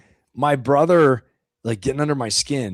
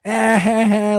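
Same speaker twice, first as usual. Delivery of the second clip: mock laughing brother; 8 semitones higher, 3 dB louder, much slower than usual. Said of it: "like, laughing at me".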